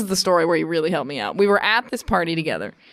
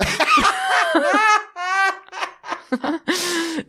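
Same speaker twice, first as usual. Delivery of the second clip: long, high cackling laugh; laughing fit; 13 semitones higher than usual. Real, not acted. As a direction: dissolve into laughter. Still laughing at story.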